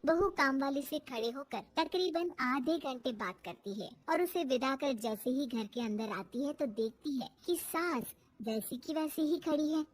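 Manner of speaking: ordinary delivery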